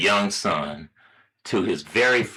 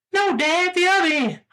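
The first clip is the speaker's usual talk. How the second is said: put-on insistent high-pitched kid; very high small-boy voice; little boy. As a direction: correct Dad in a tiny child's voice. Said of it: "the little boy starts in again".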